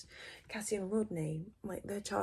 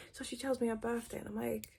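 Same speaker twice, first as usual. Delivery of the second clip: ordinary delivery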